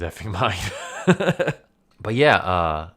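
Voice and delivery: breaking into laughter at the end; laughing. Real, not acted. Quoting Leo about a brother losing it, breaking into laughter. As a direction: crack up on the last words.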